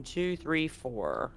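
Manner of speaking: ordinary talk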